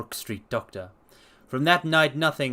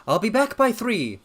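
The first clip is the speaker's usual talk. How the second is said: brisk, higher gentleman detective; Holmes; 9 semitones higher, 5 dB louder than usual. Acted. Detective announcing his return, higher brisk voice. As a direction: clip it like a confident sleuth. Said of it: "said he".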